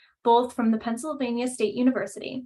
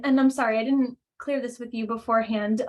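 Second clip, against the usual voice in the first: ordinary talk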